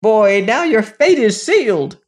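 high raging creature voice; dwarf scream. Acted. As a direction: threaten the boy as a raging dwarf. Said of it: "he screamed".

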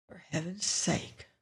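very soft, queasy mutter; barely voiced, as if nauseated; sickened mutter. A narrator's reading. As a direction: mutter it under your breath, sickened. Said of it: "Lois muttered, sickened".

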